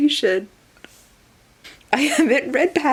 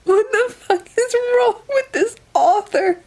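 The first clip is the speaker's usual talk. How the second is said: high and loud, near crying; real laughing outrage; outraged disbelief; 12 semitones higher, 4 dB louder than usual. Real, not acted. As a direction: cry out at the author in disbelief.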